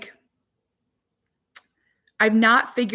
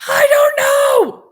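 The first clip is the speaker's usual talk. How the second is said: put-on high, drawn-out child's wail, much louder; whiny kid voice; 11 dB louder, slower than usual. Mother voicing her son's wail about lost snow pants. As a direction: whine it like a little boy who lost his pants.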